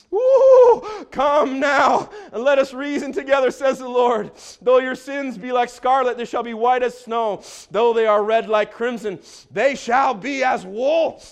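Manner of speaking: loud, whooping preacher's declamation; full-force scripture preaching. Real, not acted. Preacher calling sinners with the Lord's words, loud declamation. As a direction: open on a high whoop, then thunder the verse.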